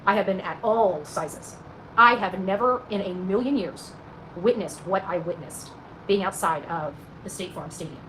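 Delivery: ordinary talk, nothing marked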